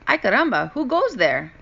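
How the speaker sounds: put-on high excited Spanish-accented voice; Mexican accent. A narrator's reading. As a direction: call out into the dust. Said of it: "asked Skippy John Jones".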